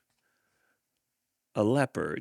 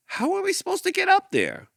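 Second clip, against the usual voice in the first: squeaky leopard; 4 dB louder than usual. Acted. Leopard asks.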